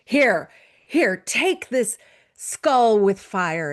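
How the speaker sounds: the witch shouting her away, rejecting blessings; Baba Yaga, loud. Acted. Baba Yaga drives Vasilisa off with a loud scornful hag voice. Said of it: "And Baba Yaga says".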